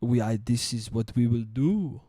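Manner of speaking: low-pitched, muddled fake Italian accent; botched Italian accent. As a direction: attempt an Italian accent that drifts toward French. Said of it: "It's not even an Italian accent".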